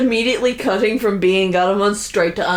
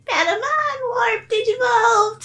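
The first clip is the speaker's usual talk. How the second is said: high mimicked cartoon shout; tired Patamon imitation. Co-host mimicking how that recorded take sounded, high off-key squeak. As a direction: imitate a slightly off squeaky battle cry, a notch below the real thing. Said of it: "Whereas that was like".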